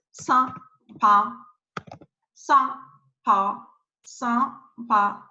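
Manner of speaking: "sampa" split into two syllables, first one a French nasal A; French "sampa" syllable by syllable, no M in the nasal vowel; teacher's careful, separated "sampa". Shown as a demonstration. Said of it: "you pronounce A".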